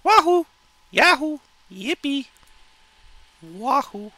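high falsetto whoops; cartoon cheering. Cartoon character cheering to himself, high falsetto whoops.

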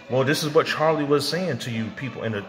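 ordinary delivery

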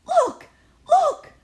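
very high, loud excited cries; children shouting. Acted. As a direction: kids pointing and yelling. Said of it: "the children shouted".

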